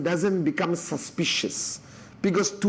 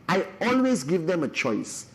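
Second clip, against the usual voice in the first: ordinary talk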